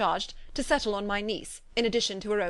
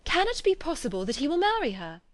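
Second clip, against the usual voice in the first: higher, amazed disbelief; incredulous cry; 7 semitones higher than usual. A narrator's reading. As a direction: Elizabeth cries out in amazement. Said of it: "cried Elizabeth".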